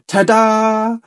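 high proud ta-da; ta-da cry. A narrator's reading. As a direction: show it off with a flourish. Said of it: "she cried".

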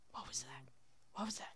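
very soft, low wary question; hushed alarm. Pretend. Player hearing something nearby, hushed wary question. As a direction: freeze and ask it under your breath.

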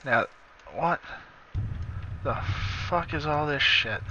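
ordinary delivery

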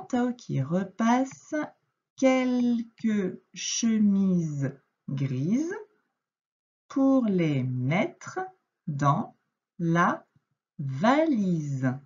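very slow read-aloud, word by word with pauses; slow syllable reading. Shown as a demonstration. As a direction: read it out slowly for a beginner. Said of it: "Donc, je reprends".

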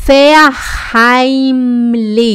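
"Verheimlich" stretched out very slowly; slow repetition. Shown as a demonstration. Teacher repeats "Verheimlich" slowly for the class. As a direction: draw out every syllable of "Verheimlich". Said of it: "vou repetir isso várias vezes".

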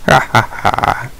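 breathy slow villain laugh; unvoiced slow evil chuckle; mock evil laugh. Gloating over eating the pigs.

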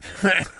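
put-on high old-man cackle; malicious cackle. A narrator's reading. Old newborn mocking the swaddling garment, malicious cackle. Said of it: "With a malicious cackle".

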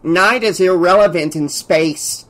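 lightly voiced reedy underling; a touch of reedy know-it-all; reedy assistant. A narrator's reading. Assistant butts in from behind his boss, slightly reedy. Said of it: "came the reedy voice of Bardot's assistant".

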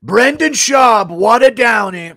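high, slow, loud drawn-out impression; hammed-up, drawn-out Trump voice. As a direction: stretch it out loud and high like a blustering politician. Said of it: "[name] Trump. Describing Brendan Schaub impression".

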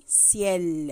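ciel said slowly with a clear s sound; soft c in ciel. Shown as a demonstration. Teacher showing pupils the right way, slow and clear. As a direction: stretch out ciel, soft s at the start. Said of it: "Je dis pas kiel".

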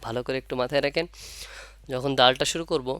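usual speaking voice

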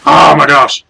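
startled exclamation. Real, not acted. Sudden scare in game.

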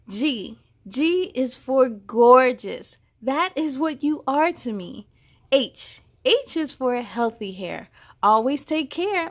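put-on bright, measured read-aloud voice for a child; storybook reading. A narrator's reading. Reading a hair alphabet book aloud to a child, storybook voice. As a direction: read each line out like a picture book.

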